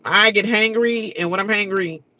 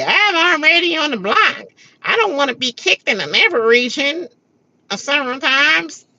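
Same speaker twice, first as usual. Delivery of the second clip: higher, put-on whiny voice; mocking Felicia; 8 semitones higher, 3 dB louder than usual. Mocking Felicia's diary room whining, put-on higher voice. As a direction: whine it like a self-pitying houseguest.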